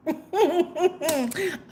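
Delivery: soft closed-mouth giggle; a pleased, high little run of chuckles; delighted chuckle. Real, not acted. Delighted at the Empress card.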